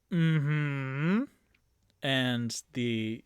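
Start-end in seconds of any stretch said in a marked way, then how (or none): none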